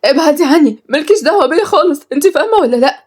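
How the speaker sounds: high, alarmed warning; panicked. A narrator's reading. Frightened servant tells the girl to stay away, panicked. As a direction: push her away in high-pitched panic. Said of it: "اتكلمت بفزع".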